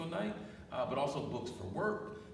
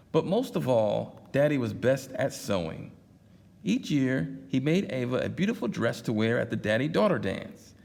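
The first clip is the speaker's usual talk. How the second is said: reading a picture book aloud, louder and livelier; story narration; 2 semitones higher, 9 dB louder than usual. Acted. Dad reading a picture book to his daughters, lively storytelling. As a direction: tell it like bedtime storytime.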